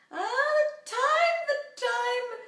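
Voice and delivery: high, loud, slow wail at the timer; hammed-up panicked cry; mock panic. Real, not acted. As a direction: shriek in comic alarm at the clock.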